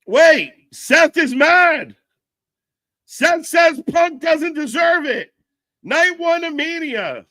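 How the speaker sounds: high, loud, stretched-out exclamations; excited shout. Real, not acted. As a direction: yell the update slowly, way up high. Reacting live to wrestler's anger.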